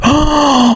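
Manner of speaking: high sudden gasp; real gasp of realization; sharp gasp. Real, not acted. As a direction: gasp as it hits you.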